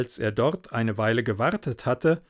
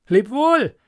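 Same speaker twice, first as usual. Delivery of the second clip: cheerful farewell; 12 semitones higher, 7 dB louder than usual. Acted. Hans calls farewell.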